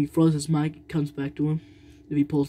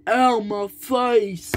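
mock pain; 6 semitones higher, 3 dB louder, much slower than usual. Toy gets hurt.

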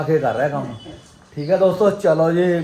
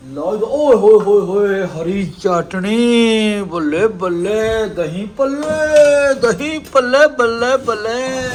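high, loud, slow sing-song cheer; excited; 5 semitones higher, 5 dB louder, slower than usual. Real, not acted. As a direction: chant it out with glee. Celebrating the food.

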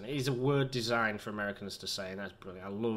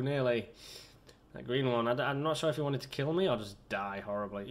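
normal talking voice

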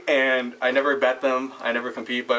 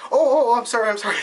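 high, louder imitation of a startled apology; flustered apology; 10 semitones higher, 4 dB louder, faster than usual. Waitress apologises for breaking away, high flustered voice.

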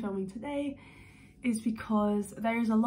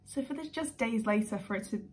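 ordinary talk, nothing marked

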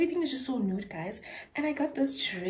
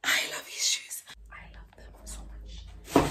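adoring whisper. Real, not acted. Gushing to viewers over her New Balance, whispered. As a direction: breathe the words to the shoes.

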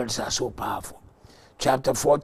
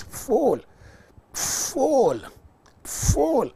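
each full climbs higher; climbing ecstatic repetition; rising excitement; 13 semitones higher, 5 dB louder, much slower than usual. Real, not acted. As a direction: build the word full up and up. Vision building.

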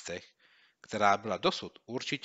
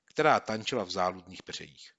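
plain reading voice

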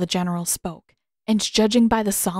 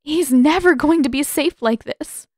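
louder, higher, on the edge of tears; voice cracking; 6 semitones higher, 6 dB louder than usual. Acted. Katara pleading with Pakku, her voice cracking. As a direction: push it out as the voice cracks. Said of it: "her voice cracked again".

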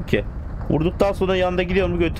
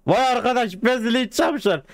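high astonished exclamation; amazed; 9 semitones higher than usual. Real, not acted. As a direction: throw up a high cry of disbelief. Amazed shout at stolen station.

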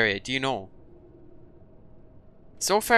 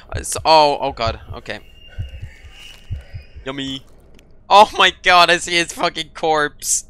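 panicking gamer; 8 dB louder than usual. Real, not acted. Sees corpse, panics.

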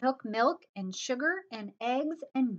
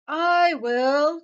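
loud, higher storybook dog; eager dog cry; 4 semitones higher, 11 dB louder than usual. A narrator's reading. Dog jumps at the offer of cake, loud eager cry. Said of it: "cried the dog".

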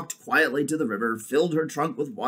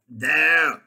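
loud, lightly voiced warthog; warthog character; 3 semitones higher, 8 dB louder than usual. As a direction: call it out as the warthog. Warthog figure speaks in story.

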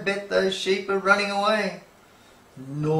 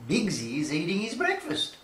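usual speaking voice

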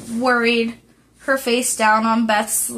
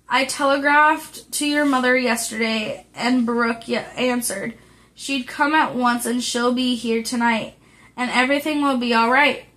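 ordinary delivery

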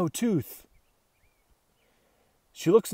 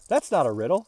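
plain reading voice

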